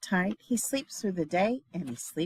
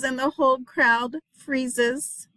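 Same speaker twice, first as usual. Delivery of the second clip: ordinary delivery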